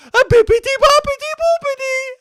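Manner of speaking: mock Italian gibberish. Showing how Italians supposedly sound, high mock Italian. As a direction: parody Italian talk as bouncy gibberish. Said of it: "Italians sound like this".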